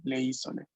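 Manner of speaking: "isole" with the accent on the first I; stress on first syllable. Shown as a demonstration. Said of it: "El acento está siempre en la primera sílaba".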